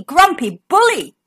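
usual speaking voice

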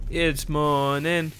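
slow drawn-out announcement. Acted. Announcing morning slowly.

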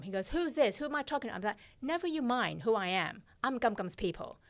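ordinary talk, nothing marked

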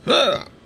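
sharp high gasp of shock. Real, not acted. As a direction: gasp in sudden horror. Gear vanishes on equip.

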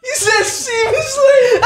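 high-pitched laughing disbelief. Real, not acted.

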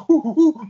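high, loud playful monkey hoots; imitating a toddler hooting like a monkey. Host retells daughter goofing as little monkey, loud high hoots.

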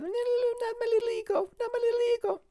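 tiny ego voice. Pretend. Filmmaker mocks his own small ego, high squeaky voice.

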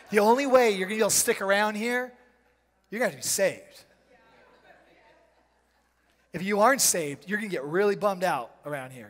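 loud, fast preacher's warning; full-force preaching. Acted. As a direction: press the point hard and fast.

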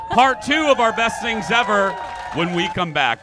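loud, high hype shout to the break; excited announcer tease. Acted. Host teasing part two before the break, loud high hype shout. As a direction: sell the next segment like a radio promo.

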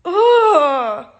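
a real anguished cry breaking out; crying groan. Real, not acted. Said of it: "I'm sorry that I'm crying".

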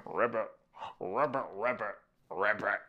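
short, playful frog croaks; frog ribbits. Pretend. Reader showing children the green frog, playful ribbits.

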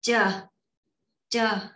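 "chờ" with a tense, tightened "ch"; taut, separately stressed "chờ"; tense consonant. Shown as a demonstration. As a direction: tighten the start of each "chờ". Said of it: "đọc là chờ mà căng nha".

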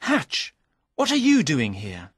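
high startled name, then puzzled question; acted astonishment, pitch jumping up; surprised. A narrator's reading. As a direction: greet an unexpected arrival in surprise.

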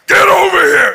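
high, loud enraged shout; shouting Goliath. Retold or imitated. Goliath charges at David, enraged shout. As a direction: bellow it as he charges. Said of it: "I can imagine".

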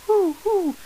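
very high pigeon hoo in falsetto; falsetto coo. Shown as a demonstration. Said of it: "begins with a simple falsetto".

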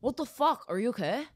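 lightly voiced bewildered California dude; mock husband's puzzled check-in; imitating baffled husband. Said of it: "how good your impression is of him".

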